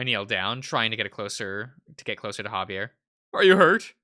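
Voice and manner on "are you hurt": high loud worried question. Acted. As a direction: ask with anxious concern. Worried about friend.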